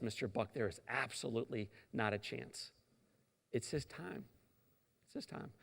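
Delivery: a hint of a solemn doctor; grave doctor. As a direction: hush it like bad news. Doctor grave, fading soft.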